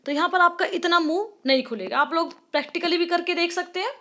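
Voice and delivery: ordinary talk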